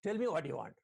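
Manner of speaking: a hint of an amused dad seeing through it; knowing father. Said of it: "So the father will ask".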